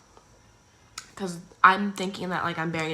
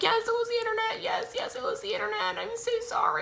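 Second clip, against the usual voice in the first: high, small voice, pleading; meek apology. Retold or imitated. Caught teens to angry neighbor, high meek apology. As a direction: play it like a guilty kid.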